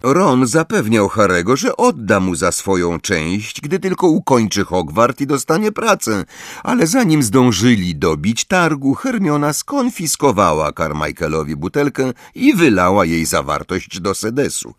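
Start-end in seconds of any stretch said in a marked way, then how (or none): none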